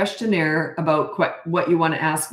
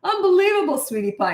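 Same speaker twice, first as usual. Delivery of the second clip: high, hammed-up "Unbelievable"; dad faking awe at the burnt pie; playful overdone praise, higher; mock amazement; 13 semitones higher than usual. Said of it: "He called me Sweetie Pie".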